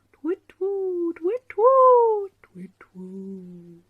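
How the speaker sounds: high hooting owl call, fading away; owl hoots. Acted. Speedy Owl flying past, hooting high then fading.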